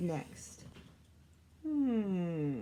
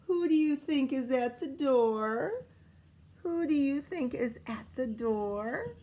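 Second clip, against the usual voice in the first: put-on reading to children; 2 semitones higher than usual. Teacher reading picture book aloud.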